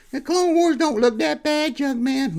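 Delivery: very high falsetto rebuke; high scolding voice. Acted. Cuts into the host's Clone Wars rant, scolding in high falsetto. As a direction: scold in a drawn-out, put-on high voice.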